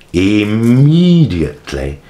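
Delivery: "immediately" drawn out slowly and loud; emphatic stress. Real, not acted. Telling how fast the superior came, "immediately" hugely stretched. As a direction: stretch and swell "immediately" for outrage.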